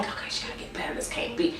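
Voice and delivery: unvoiced whisper to herself. Reviewer whispering her hope that Kelly will improve. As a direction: breathe it out as a private thought. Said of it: "I've been like".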